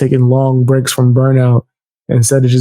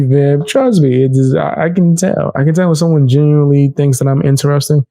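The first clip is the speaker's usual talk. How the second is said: ordinary delivery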